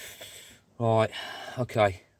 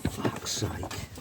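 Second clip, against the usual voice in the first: whispered curse; 4 dB quieter than usual. Real, not acted. Curse under his breath.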